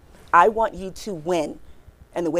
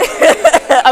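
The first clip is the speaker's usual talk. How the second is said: high loud burst of laughter; 7 semitones higher, 11 dB louder than usual. Real, not acted. Speaker ending the story with loud laughter.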